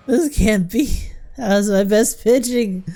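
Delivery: hammed-up tearful disbelief; mock crying. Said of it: "No need to cry, man".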